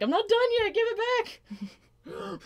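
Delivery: very high, drawn-out whiny shout; whiny yell. Retold or imitated. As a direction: wail after the thief. Chasing the boy for her drawing.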